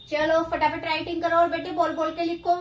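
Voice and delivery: ordinary delivery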